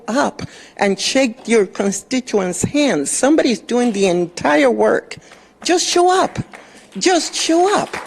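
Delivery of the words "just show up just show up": exasperated demand. Real, not acted. Speaker at the podium scolding an absent councilman, exasperated demand. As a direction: pitch jumps much higher, each word hammered home.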